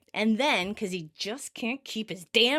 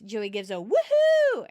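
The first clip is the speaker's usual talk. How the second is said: ordinary talk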